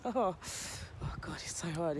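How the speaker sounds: soft, strained words through real foot pain; wincing in pain. Real, not acted. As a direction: breathe it out as the pain peaks. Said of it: "sharp shooting pain through my foot".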